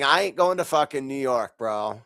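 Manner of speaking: ordinary talk, nothing marked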